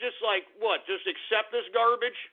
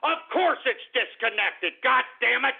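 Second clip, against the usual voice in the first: exasperated yelling at a recording; angry shouting; 4 semitones higher than usual. Real, not acted. Host finds D-Ray's number disconnected, angrily exasperated. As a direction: shout it down, higher as it goes.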